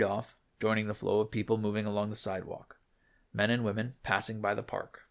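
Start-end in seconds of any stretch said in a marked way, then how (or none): none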